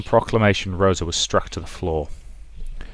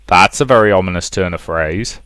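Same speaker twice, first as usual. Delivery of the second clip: ordinary talk, nothing marked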